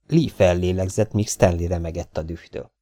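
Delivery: ordinary delivery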